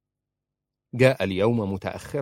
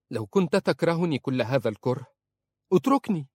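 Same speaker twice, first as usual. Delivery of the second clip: ordinary delivery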